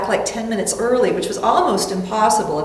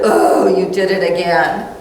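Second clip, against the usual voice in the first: harsh self-scolding with a laugh; 4 semitones lower, 4 dB louder than usual. Shown as a demonstration. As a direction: scold yourself, a bit louder. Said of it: "Instead of".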